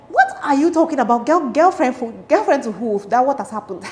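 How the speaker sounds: loud, high mock outrage; indignant disbelief. Girl answering boy who wants her as girlfriend, indignant disbelief. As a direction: throw the questions back, scandalized. Retorts to boy's confession.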